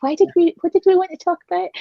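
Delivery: quick, high, puzzled question; puzzled wondering. Retold or imitated. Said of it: "we think".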